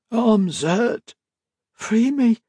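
put-on high, broken pleading child voice; feral sick girl. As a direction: beg weakly like a feral child. Feverish chained girl pleads.

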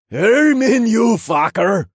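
put-on high, loud drunken holler; rowdy drunk man calling out; slurred through laughter. A narrator's reading. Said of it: "slurred through his laughter".

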